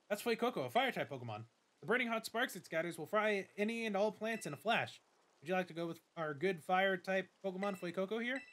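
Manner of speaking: lightly voiced formal school director; a hint of a polite headmaster welcoming a student; Director Clavel. Director greets the new student, lightly formal voice.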